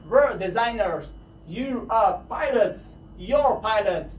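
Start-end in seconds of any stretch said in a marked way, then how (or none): 1.6-2.8 slow You are, said apart; careful full form You are; drawn out You are. Shown as a demonstration. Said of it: "باید شما اینها رو حتماً بدونید".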